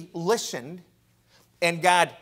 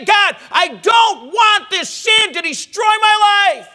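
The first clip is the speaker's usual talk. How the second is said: loud, rising fervent preaching; preaching at full force; 10 semitones higher, 12 dB louder than usual. Acted. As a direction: build to a loud pleading cry.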